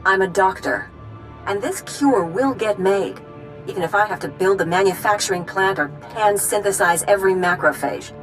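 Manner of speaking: insistent, indignant declaration; angry. Acted. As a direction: vow it with controlled anger. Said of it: "I think she's angry".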